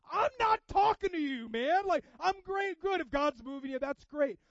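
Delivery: loud, high, emphatic retort; acted, high-pitched yell of reassurance; exasperated shout. Acted reply to a convicted listener.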